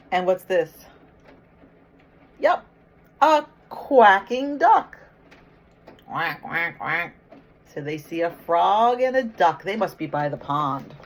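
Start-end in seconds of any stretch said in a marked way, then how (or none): 2.4-5.0 lively storybook read. Acted. Teacher reading picture book to kids. 6.1-7.1 duck quacks. Retold or imitated. Teacher imitating a duck for kids.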